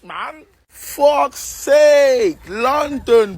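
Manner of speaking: usual speaking voice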